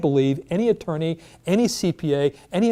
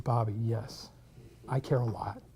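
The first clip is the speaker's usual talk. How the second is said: much softer and lower, earnest; quiet sincere; 5 semitones lower, 11 dB quieter than usual. Retold or imitated. Husband telling his wife he cares, soft and sincere. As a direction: confess it gently, meaning every word.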